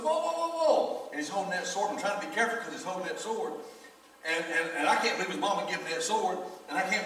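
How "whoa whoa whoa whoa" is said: high, slow, drawn-out whoas; soothing whoa. Retold or imitated. Pastor calming the armed teen, high and slow. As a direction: ease him down like a spooked horse. Calming boy holding sword.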